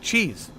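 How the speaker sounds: Cheese said high and stretched. Shown as a demonstration. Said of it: "Like cheese".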